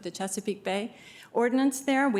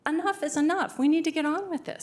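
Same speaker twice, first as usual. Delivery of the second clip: passionate plea, pitched higher; 6 semitones higher than usual. Real, not acted. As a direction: drive it home with real conviction. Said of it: "you can tell I'm passionate about this".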